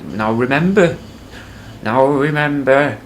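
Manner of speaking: put-on high, slow doddering old man reminding; quavering old father drawing out the words; old dad voice. Old dad to grown son, doddering reminder.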